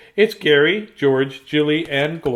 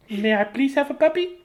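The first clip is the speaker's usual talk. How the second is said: higher, lightly voiced begging kid; a hint of a whiny child asking nicely; pleading child; 9 semitones higher than usual. Child begging parent for a puppy, mild high plea. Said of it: "That's how it works in our house".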